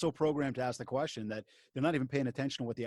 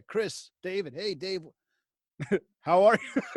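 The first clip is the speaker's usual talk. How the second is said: chirpy phone greeting; 7 semitones higher, 6 dB louder, slower than usual. Retold or imitated. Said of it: "calling, say".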